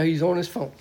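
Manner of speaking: ordinary talk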